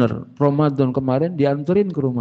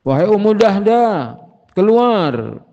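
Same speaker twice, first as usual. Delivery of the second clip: raised, high voice calling someone outside; 9 semitones higher, 5 dB louder than usual. Acted. Husband back home summons his wife out of the garden, calling out. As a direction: call loudly into the house for your wife. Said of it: "lalu dia panggil istrinya".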